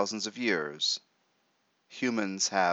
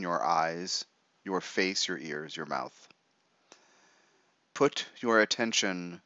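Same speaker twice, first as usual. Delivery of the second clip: right in your ear. Acted. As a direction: talk them gently into relaxing. Leads a guided meditation.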